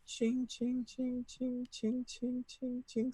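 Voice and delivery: high, soft jingling ching ching; high soft bell imitation; sleigh bell jingle. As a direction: mimic tinkling sleigh bells.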